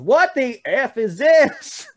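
high, slow, loud mock hillbilly outrage; incredulous hillbilly. Imitating the hillbilly fan yelling at the British wrestler, slow and loud. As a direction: drag out the disbelief like a big trucker. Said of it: "he just goes".

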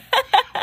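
short, very high laugh; quick high burst of laughter. Real, not acted. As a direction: let out a short squeal of laughter. Joking about being yelled at.

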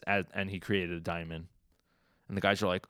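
ordinary talk, nothing marked

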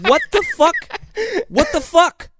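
high-pitched incredulous exclamation; baffled outburst. Acted. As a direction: blurt it out in high-pitched disbelief. Exasperated outburst at the movie's plot.

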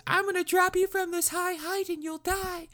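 squeaky falsetto threat; hammed-up tiny villain, extremely high-pitched; Rinku. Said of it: "And Rinku, at one point".

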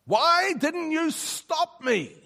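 high, drawn-out shout of protest; anguished re-enacted shout to heaven; shouting at God. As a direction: cry it out slowly and loudly, demanding an answer. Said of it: "shouting at God".

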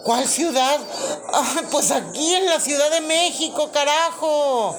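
loud, high, frantic plea; panicked caller. Acted. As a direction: beg for help in a panic.

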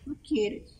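very high, cutesy mimicry; tiny high-pitched pleading question; sweet little girl. Shown as a demonstration. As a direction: coax like an adorable child. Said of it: "de niña linda".

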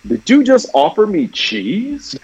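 put-on slow, high crotchety old man; lightly voiced old man grumble; Ed Asner old man voice. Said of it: "Or whatever that line was from Boondocks".

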